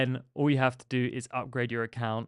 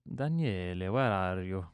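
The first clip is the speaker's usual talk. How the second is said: put-on Italian accent; 4 semitones lower, 6 dB quieter than usual. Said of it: "do the Italian accent".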